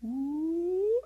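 high sliding "ooh"; a long, high ooh that climbs; rising "ooh". Retold or imitated. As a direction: imitate a swelling sensation with a rising ooh. Imitates the sensation he felt.

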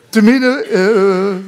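can't hold the laugh back as you start. Real, not acted. Teller recalling the church ladies, laughing out loud.

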